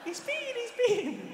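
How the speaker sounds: very high falsetto squeal; mock child voice, piping with excitement; excited kids. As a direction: mimic thrilled children in a squeaky voice. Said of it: "kids run in".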